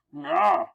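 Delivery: very high, short comic-book yell; high cry. Acted.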